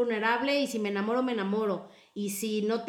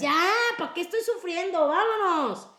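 high, louder, fed-up "let's go"; resolute outburst; 8 semitones higher, 4 dB louder than usual. Retold or imitated. Voicing the rider on the coaster, high determined cry. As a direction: snap out of the fear and decide. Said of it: "tienes que decir".

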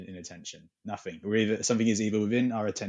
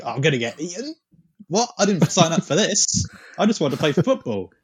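panicked athlete; 8 semitones higher, 7 dB louder than usual. Pretend. Voicing a frightened athlete.

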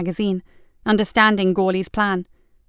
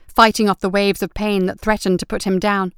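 ordinary delivery, nothing marked

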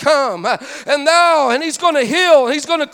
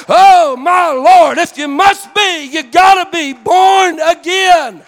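preaching climax; 3 semitones higher, 5 dB louder, slower than usual. Real, not acted. Preacher to his congregation, a rising fervent cry. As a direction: lift it up and proclaim it with fire.

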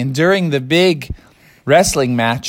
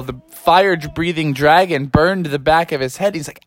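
usual speaking voice

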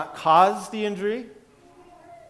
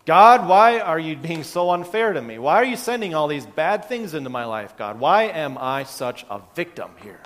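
complaining victim; 6 dB louder than usual. Retold or imitated. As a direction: plead with God like a self-pitying victim. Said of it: "are you perhaps telling him".

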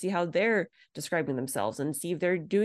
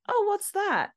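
much higher, louder mock question; curious driver; 3 dB louder, slower than usual. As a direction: imitate a puzzled driver asking, pitched way up. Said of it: "small talk with an Uber".

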